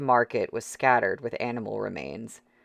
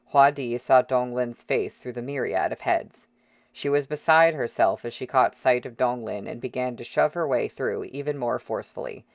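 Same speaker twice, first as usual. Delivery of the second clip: plain reading voice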